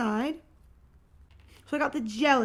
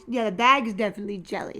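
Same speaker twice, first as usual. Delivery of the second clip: ordinary talk, nothing marked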